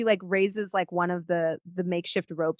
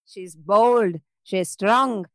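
ordinary talk, nothing marked

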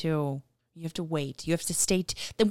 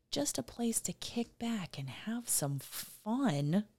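whispering; 5 semitones higher, 7 dB quieter than usual. Real, not acted. Whispered aside in praise.

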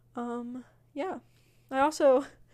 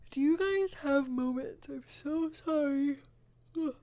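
talking through a yawn; yawn-stretched words; 4 semitones higher, slower than usual. Real, not acted. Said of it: "Gotta get those yawns out of there".

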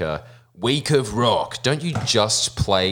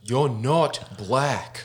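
drawn-out mocking put-down; slow mocking; 4 semitones higher, much slower than usual. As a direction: say it slowly, mockingly. Unleashing the beast, mocking.

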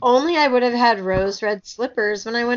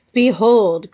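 louder narrated proclamation; crying out; 7 dB louder than usual. A narrator's reading. Pharaoh presents his future queen, crying out. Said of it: "cried Amasis".

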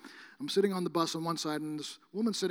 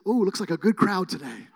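laughing; 8 dB louder than usual. Retold or imitated. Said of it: "I said".

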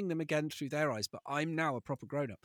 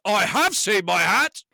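loud, slow, far louder exclamation; sudden shout; 5 semitones higher, 14 dB louder than usual. Acted. Father reading the bear remembering his hat, loud and slow. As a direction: burst out with the realisation. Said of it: "sits up and goes".

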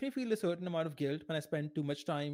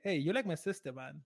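lighthearted; 4 dB quieter than usual. Shown as a demonstration. Man teasing a date that she is like his sister, lighthearted. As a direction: toss it off as friendly banter. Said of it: "Be lighthearted".

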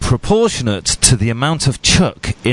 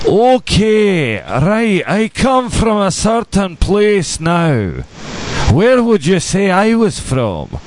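slow, higher accent demo with a Scottish lilt; slow Scottish brogue, pitched up; put-on Scottish accent; 7 semitones higher, much slower than usual. Shown as a demonstration. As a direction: lay on a thick Scottish brogue for a guessing game. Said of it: "So if I was to say".

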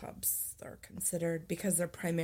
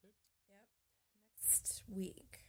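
whisper; 2 semitones higher, 16 dB quieter, slower than usual. Real, not acted. Quiet aside.

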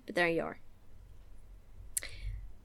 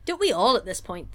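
normal talking voice

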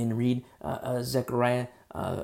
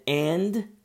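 "and" pitched up and drawn out; emphasis on "and"; 5 semitones higher, 4 dB louder than usual. Shown as a demonstration. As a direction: punch the word "and". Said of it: "with emphasis".

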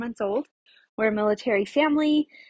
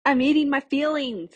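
higher, playful cry for help; mock plea for help; 4 semitones higher, 4 dB louder than usual. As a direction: plead in mock distress, pitched up. Mock cry for help over eating.

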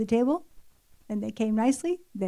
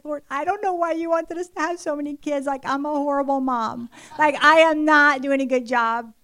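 exasperated prayer; 3 semitones higher than usual. Retold or imitated. Overwhelmed mother telling God she is failing, exasperated. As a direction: vent self-doubt to the Lord.

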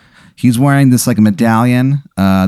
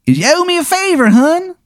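high-pitched, hammed-up Baltimore accent; cartoonishly high Baltimore accent; terrible Baltimore accent. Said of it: "his terrible accent".

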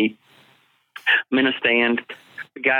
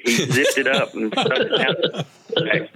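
slow, higher words through real laughter; laughing; 5 semitones higher, slower than usual. Real, not acted. Hunter recalls his cameraman zipping the bag, laughing. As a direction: lose it laughing mid-sentence. Cracking up over bag story.

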